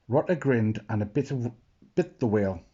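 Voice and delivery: ordinary delivery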